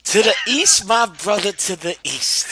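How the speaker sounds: loud, high preacher's chant; street preacher. Retold or imitated. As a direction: call it out like a corner preacher.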